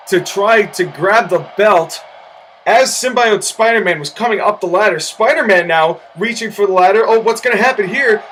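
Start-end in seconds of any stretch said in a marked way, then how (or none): none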